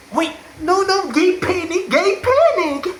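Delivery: high, flustered yelp; mock anime fluster; flustered panic. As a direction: squeak it out in a panic. Character flustered.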